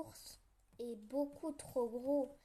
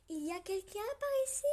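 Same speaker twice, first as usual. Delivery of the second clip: high, quick frog peeking in; chirpy little frog asking; 7 semitones higher, 7 dB louder, much faster than usual. Acted. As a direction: petite voix de grenouille rapide. Frog peeks into mitten.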